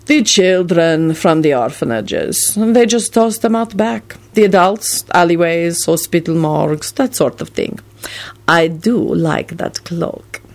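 bored vampire lord. A narrator's reading. Radu bored, listing body sources.

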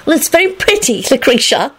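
high, polite compliment; politely sweet and high. A narrator's reading. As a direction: pay a courteous compliment in a lifted voice. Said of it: "Solange said politely".

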